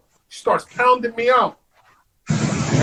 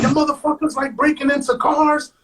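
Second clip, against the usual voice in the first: higher-pitched imitation of an accusing cop; 6 semitones higher than usual. Cop accusing two detained men of breaking into cars, accusatory. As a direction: throw the accusation like an officer.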